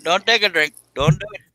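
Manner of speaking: hammed-up warning to a friend; playful warning. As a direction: tease him not to drink.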